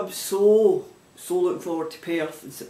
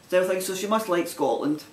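ordinary talk, nothing marked

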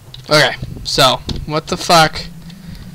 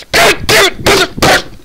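furious shout. Real, not acted. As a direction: blow up at the game. Raging at the game.

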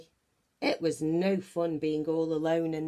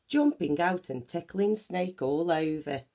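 ordinary delivery

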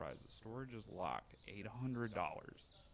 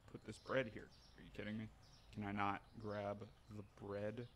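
usual speaking voice

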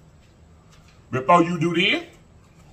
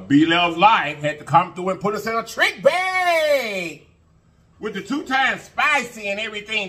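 excited; 7 semitones higher than usual. Real, not acted. Excited call-out of challenge.

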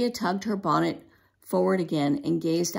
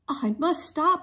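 frantic; 8 semitones higher, 3 dB louder than usual. A narrator's reading. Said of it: "she thought frantically".

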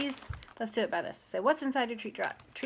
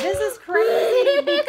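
laughing and shouting with delight, much higher; excited laughing shout; 13 dB louder than usual. Real, not acted. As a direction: burst out loud in thrilled laughter. Mom thrilled by Ireland box.

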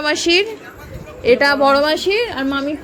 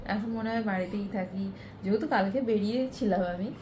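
much softer, lower hushed talk so as not to wake anyone; speaking very softly; 4 semitones lower, 13 dB quieter than usual. Real, not acted. Sister is snoring nearby, speaks very softly to not wake her. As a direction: keep it hushed and low throughout. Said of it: "আমি খুব আস্তে আস্তে কথা বলছি".

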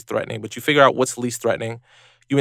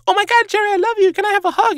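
far higher, squealing fan excitement; 4 dB louder than usual. Retold or imitated. A pop singer interrupts an interview, gushing. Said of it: "just walks up to him ... and goes".

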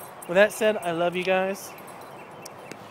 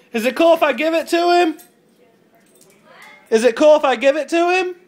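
louder, higher question called across; calling out; 7 semitones higher, 7 dB louder than usual. Real, not acted. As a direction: shout the question to the other room. Calling to wife again.

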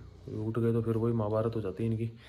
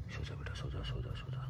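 soothing lullaby; 9 semitones lower, 12 dB quieter than usual. Real, not acted. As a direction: coax the little one to sleep gently. Lulling a baby to sleep.

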